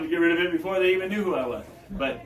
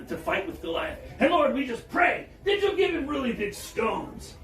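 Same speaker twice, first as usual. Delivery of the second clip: fervent Pentecostal prayer; 9 semitones higher than usual. Retold or imitated. Pentecostal lady praying over Doctor Frank, much higher and shouted. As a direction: preach it like a worked-up Pentecostal woman. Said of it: "she was wrapping up her prayer".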